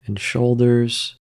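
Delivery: normal talking voice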